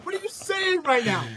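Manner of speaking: high-pitched exasperated outburst; incredulous outrage. Pretend. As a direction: demand it in comic disbelief. Ranting at Katara in disbelief.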